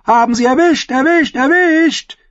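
very high, rising triumphant yell; excited calling out. A narrator's reading. Defender leaning over the edge cheering, calling out. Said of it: "rief Satin".

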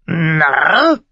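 hoher, lauter Rabenschrei mit steigender Frage; Narr? hoch und schrill wie ein Rabe krächzen; krächzender Rabe. A narrator's reading. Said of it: "gab der Rabbi ihm recht". (der Rabe äfft Mormont nach: Narr? hoch krächzend)

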